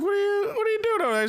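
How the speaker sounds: high, fast suspicious questioning; a hammed-up suspicious girlfriend; girlfriend imitation. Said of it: "she's like".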